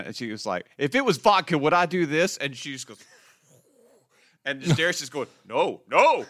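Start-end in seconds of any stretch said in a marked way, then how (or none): 0.8-2.4 higher-pitched imitation of an indignant denial; mock defiance. Acting out woman's defiant reply. 5.5-6.2 raised, louder imitation of protest; mock alarm. Voicing Darius's protest.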